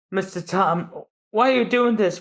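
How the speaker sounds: apprentice asking his master why; hurt apprentice. Acted. Frankie asks.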